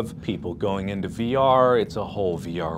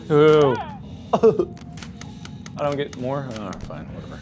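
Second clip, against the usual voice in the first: normal talking voice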